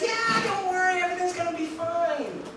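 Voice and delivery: high, breezy mock reassurance; Larry waving off his nervous friend, high and airy; voicing Larry. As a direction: toss it off like nothing could go wrong.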